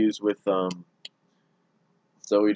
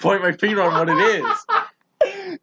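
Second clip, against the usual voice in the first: high, helpless laughing storytelling; 8 semitones higher, 4 dB louder than usual. Real, not acted. Telling a friend about the actor mix-up, laughing. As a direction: tell it through laughter, pitch climbing.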